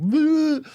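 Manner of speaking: sudden high laugh at himself; burst of laughter. Real, not acted. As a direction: laugh out at the memory. Laughing at almost crashing.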